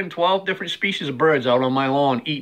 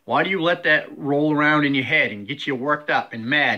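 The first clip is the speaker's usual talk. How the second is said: usual speaking voice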